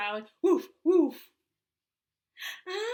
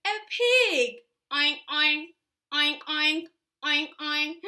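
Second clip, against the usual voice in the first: loud oink oink; pig oinking; 5 dB louder than usual. Shown as a demonstration. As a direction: snort like a pig. Pig sound for child.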